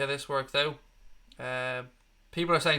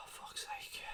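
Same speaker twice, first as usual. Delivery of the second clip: a sigh then a whispered curse; muttered under the breath, fed up; exasperated whisper; 21 dB quieter than usual. Real, not acted. Exasperated at goal kick.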